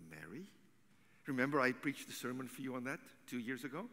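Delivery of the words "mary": very soft, low, rising name; gentle and kind. Acted. Jesus speaks to grieving Mary, gentle and kind. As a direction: call someone tenderly, almost under the breath. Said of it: "gentle, kind thing".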